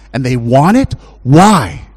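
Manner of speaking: ordinary talk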